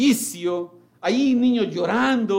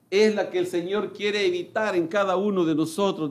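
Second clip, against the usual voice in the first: ordinary talk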